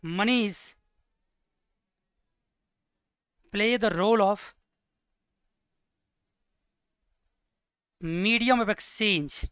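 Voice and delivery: slow, spaced words for note-taking; teacher dictating at writing speed; slow dictation. Shown as a demonstration. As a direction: dictate each word slowly. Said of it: "इसके अंदर ही लिखो".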